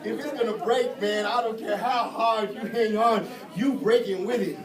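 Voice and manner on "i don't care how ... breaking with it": ordinary talk, nothing marked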